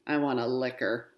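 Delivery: ordinary delivery, nothing marked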